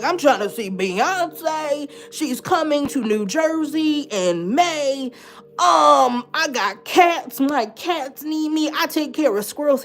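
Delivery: slow, high-pitched list of reasons; insistent pleading. Retold or imitated. Patient listing what she lives for, insistent plea. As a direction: stretch out each reason emphatically.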